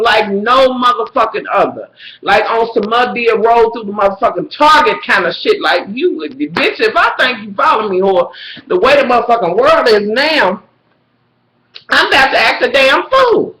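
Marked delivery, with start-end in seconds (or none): none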